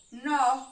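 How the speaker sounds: "Nó" drawn out alone, loud; teacher loudly marking the syllable "Nó"; syllable demonstration. Shown as a demonstration. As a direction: sound out "Nó" as one long, separate syllable. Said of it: "Eu vou marcar a sílaba".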